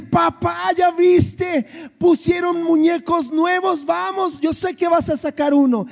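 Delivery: high, eager child's voice, imitating his girl; a father mimicking his daughter's high excited pleading; excited little daughter. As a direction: play the thrilled kid tugging at dad. Excited daughter begging at claw machine.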